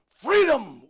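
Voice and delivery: huge high battle cry; loudest defiant scream. Retold or imitated. Tortured William Wales's last cry, loudest defiant scream. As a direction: roar it out, pitch falling away. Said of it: "suara yang paling keras".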